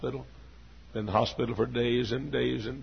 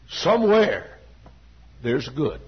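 cry out; 7 dB louder, slower than usual. Acted. Repeated prayer line, raised.

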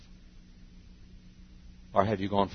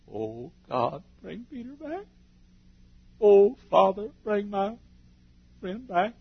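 a lightly voiced high plea to the Father; pleading prayer; 11 semitones higher, 4 dB louder, slower than usual. Jesus praying for Peter, a light high plea. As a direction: plead gently for a friend.

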